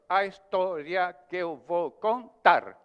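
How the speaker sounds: slow, stressed verse, each syllable counted out; scanned metre demo. Shown as a demonstration. Teacher showing students where the seventh-syllable stress falls, slow and measured. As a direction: tap out the seven syllables slowly. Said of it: "Por exemplo".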